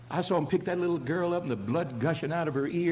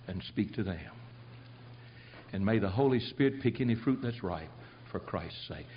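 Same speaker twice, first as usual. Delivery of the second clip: closing prayer; 3 semitones lower than usual. Acted. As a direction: pray humbly before God.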